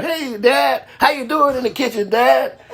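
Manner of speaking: put-on high, chirpy kid greeting Dad; sitcom kid. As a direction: imitate a polite TV child in a high-pitched voice. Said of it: "You could see how he talked".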